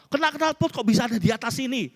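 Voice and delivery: high, fast mock interrogation; incredulous father. Father questioning son's burn-hole excuse, high incredulous mock scolding. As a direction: challenge the excuse like a suspicious dad.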